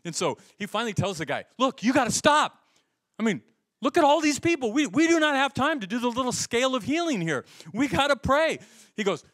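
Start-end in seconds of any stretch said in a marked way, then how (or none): 1.6-8.6 retold exasperated order. Friend snapping at slow helper.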